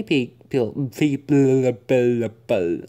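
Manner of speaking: stuck on the first sound; stammering, repeating pe; stuttering. Real, not acted. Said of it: "I'm so sorry for stuttering".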